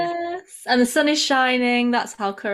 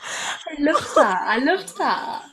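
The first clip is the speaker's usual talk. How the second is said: amused and slow; 4 semitones higher, much slower than usual. Real, not acted. Savouring the host's mistake.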